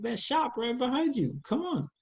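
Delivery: a hint of a sassy New Orleans lady; nosy stranger. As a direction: playfully call out the stranger. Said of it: "It's like".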